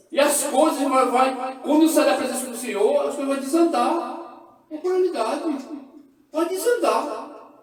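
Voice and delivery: loud, high preaching at full force; fiery preaching. Real, not acted. Preacher shouting the truth to the church. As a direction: proclaim it to the congregation.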